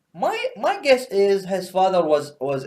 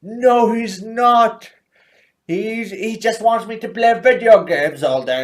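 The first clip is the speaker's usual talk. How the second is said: loud, high, drawn-out refusal; hammed-up unhinged British operator; crazed avenger; 6 semitones higher, 5 dB louder than usual. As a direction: insist wildly over a beaten enemy. Acted Thatcher refusing to stop.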